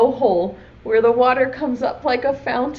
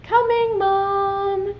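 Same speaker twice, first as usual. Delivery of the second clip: much higher called reply to mom; calling back; 10 semitones higher, much slower than usual. Acted. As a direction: sing out the answer across the house. Boy answers his mom.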